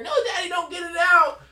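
high, loud mock-crying plea; wailing child imitation. Child begging Daddy to leave the splinter, hammed-up wail. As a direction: wail like a scared little kid.

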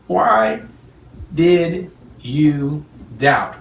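very slow, stretched out; drawn-out question. Retold or imitated. Preacher quoting Jesus asking Peter, very slow. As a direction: draw out did you doubt. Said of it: "Watch this".

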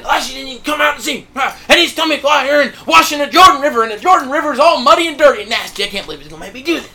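loud, high mock tirade, speeding up at the end; grumbling and complaining. Preacher imitates offended Naaman walking away, loud indignant complaining. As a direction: rant like an offended man, hammed up. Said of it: "grumbling and complaining".

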